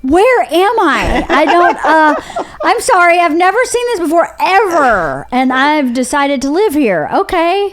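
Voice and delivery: high, hammed-up culture shock; bewildered newcomer. Naive newcomer taking in Forty-second Street, comically bewildered. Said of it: "I was like".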